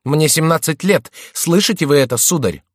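high, loud, emphatic youthful outrage; indignant protest. A narrator's reading. Seventeen-year-old challenging the man who insulted him, indignant.

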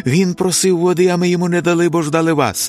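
loud, high, distraught outcry; frantic self-reproach at a high pitch; anguished shout. A narrator's reading. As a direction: cry out in guilt and despair. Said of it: "закричав Ілько".

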